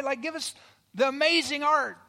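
loud, high-pitched protest from the back; grumbling heckler. Acted. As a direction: shout out an impatient complaint. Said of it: "somebody grumbles in the back and goes".

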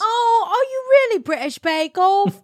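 loud, high mock British accent. Teasing her partner, loud mock British accent. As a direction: ham up a posh British accent.

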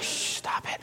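hushed whisper to quiet them; urgent whisper. Acted. Jesus hushing the excited crowd in a whisper.

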